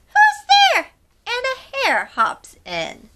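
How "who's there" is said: tiny squeaky high cry; mouse crying out. A narrator's reading. Mouse calling into the dark den. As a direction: squeak out a frightened question. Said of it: "Mouse cries".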